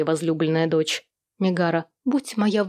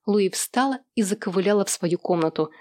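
ordinary delivery, nothing marked

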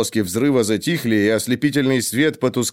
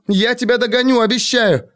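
high desperate urging; urgent shouting; 10 semitones higher than usual. A narrator's reading. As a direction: push him away, shouting. Urging him to flee.

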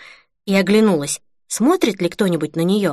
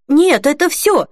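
much higher, apologetic reply; guilty; 8 semitones higher, 4 dB louder than usual. A narrator's reading. Customer glancing at Holly as he answers, guilty. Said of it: "сказал мужчина с виноватым видом".

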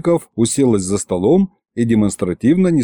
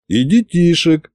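serious; 6 semitones higher than usual. A narrator's reading. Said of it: "серьёзно кивнул я".

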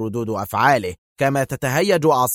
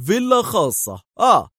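high-pitched, forceful declaration; hard, high-pitched verdict; stern; 11 semitones higher than usual. A narrator's reading. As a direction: pronounce it severely, voice raised in pitch. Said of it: "ولوح به في صرامة قائلًا".

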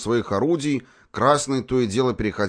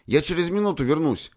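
calling out; 9 semitones higher than usual. A narrator's reading. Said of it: "крикнул он".

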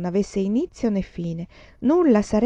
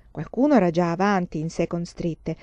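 usual speaking voice

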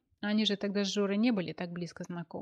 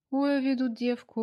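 higher, louder folk-style wail; mock lament; 6 semitones higher, 5 dB louder than usual. Georgy teasing Anya on the phone, mock lament. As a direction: complain to nobody like an old village wailer. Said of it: "неизвестно кому пожаловался Георгий".

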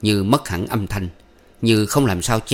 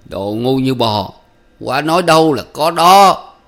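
slow start, rising higher and louder; feeble, wheezy voice; 4 semitones higher, 7 dB louder than usual. A narrator's reading. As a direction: drag out a laboured retort. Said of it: "phèo phao tiếp lời".

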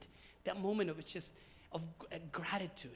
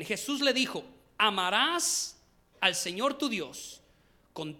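loud, emphatic preacher's Bible reading; scripture reading; 7 dB louder than usual. Real, not acted. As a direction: proclaim the passage with measured force. Reads scripture aloud. (lectura bíblica solemne y enérgica)

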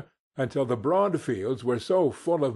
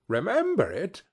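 higher, eager exclaim; fervent cry; 6 semitones higher than usual. A narrator's reading. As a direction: burst out with feeling. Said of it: "cried Scrooge with fervour".

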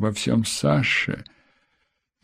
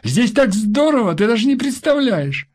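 put-on high excited little boy; excited child; 12 semitones higher, 3 dB louder than usual. A narrator's reading. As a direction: gush like a thrilled kid. (восторженный детский голос)

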